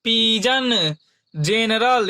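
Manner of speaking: slow, drawn-out एज; teacher spelling out एज slowly; slow example word. Shown as a demonstration. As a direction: stretch एज out for the class. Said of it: "जैसे".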